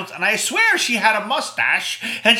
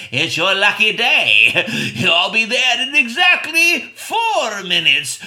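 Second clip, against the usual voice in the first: slow, louder menacing voice with a laugh; sinister caller; 5 dB louder, slower than usual. Retold or imitated. Mystery caller taunting the babysitter, slow menacing voice. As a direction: tease the victim slowly. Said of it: "and the voice said".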